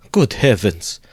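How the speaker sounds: a touch of a startled exclamation; understated dismay; mild cry. Acted. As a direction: gasp it out, only lightly shocked. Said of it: "Cried Pulcheria Alexandrovna".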